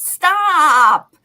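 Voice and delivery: loud, high, drawn-out exasperated cry; exasperated shout. Real, not acted. Co-host fed up with everything being political, exasperated shout.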